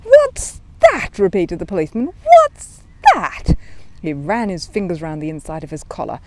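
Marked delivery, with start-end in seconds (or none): 0.0-1.2 high, loud, startled repeat; startled policeman. Acted. 2.2-3.5 high, loud, startled repeat; startled policeman. Acted.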